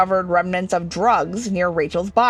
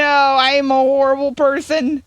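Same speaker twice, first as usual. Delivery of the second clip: hammed-up despair; 5 semitones higher, 4 dB louder than usual. Character panicking.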